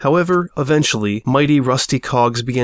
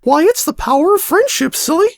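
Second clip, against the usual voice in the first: much higher, silly squeaky voice; goofy high-pitched gnome; 4 dB louder than usual. Acted. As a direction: squeak it like a goofy little smurf. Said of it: "goofy, high-pitched voice".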